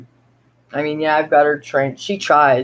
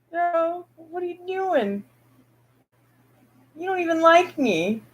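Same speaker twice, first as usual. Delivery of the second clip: higher, soft teasing to a pet; pet talk; 10 semitones higher, 5 dB quieter than usual. Real, not acted. Owner teasing her cat that came back, soft high pet talk. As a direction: tease the cat gently.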